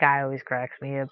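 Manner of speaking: normal talking voice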